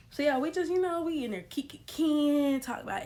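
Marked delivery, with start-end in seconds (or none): none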